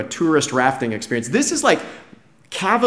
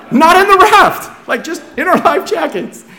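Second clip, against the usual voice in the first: incredulous outburst; 13 semitones higher, 6 dB louder than usual. Retold or imitated. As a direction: squeal it in comic disbelief. Incredulous aside amid laughter.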